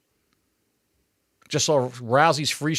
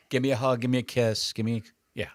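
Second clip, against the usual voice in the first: ordinary talk, nothing marked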